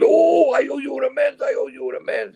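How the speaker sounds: loud wail, then high-pitched repeated plea; excited stranger. Retold or imitated. Stranger busting through the crowd to make amends, imitated outcry. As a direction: cry it out like a man bursting through a crowd. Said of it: "this is him, not me".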